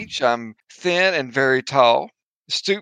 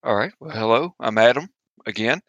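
ordinary delivery

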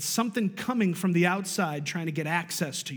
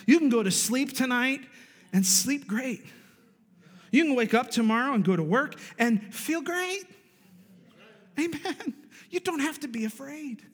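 higher, lifted preaching voice; preaching uplift; 8 semitones higher than usual. Acted. Pastor to congregation, reassuring with rising pitch. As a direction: lift them up with hope.

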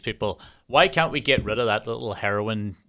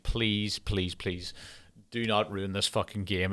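hushed, softer begging, please after please; quiet pleading; 2 semitones lower, 7 dB quieter, slower than usual. Retold or imitated. A fan watching his star go down begs quietly, softer voice. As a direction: murmur a fearful plea under your breath. Begging Mahomes not to be injured.

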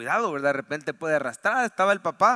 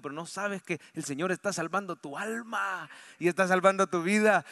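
ordinary talk

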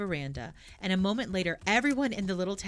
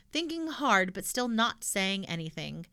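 ordinary delivery, nothing marked

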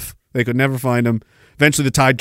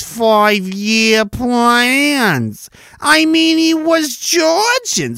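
higher, slow, smug lecturing voice; smug Janice voice; 5 semitones higher, slower than usual. Pretend. Janice lecturing the streamer, smug and higher. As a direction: preach it slowly, condescending. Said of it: "she says".

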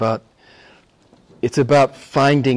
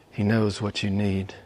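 softer and lower, hushed; quiet restatement; 6 semitones lower, 8 dB quieter than usual. Acted. Preacher repeating the point to the congregation, hushed. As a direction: let it settle gently.

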